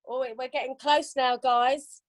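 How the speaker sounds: usual speaking voice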